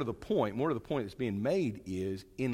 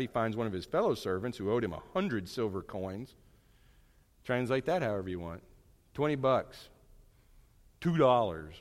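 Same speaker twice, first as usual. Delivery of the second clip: ordinary delivery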